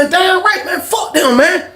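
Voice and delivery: shouting a challenge to the whole cafeteria; high challenging shout. Retold or imitated. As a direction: square up to everyone. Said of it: "I said".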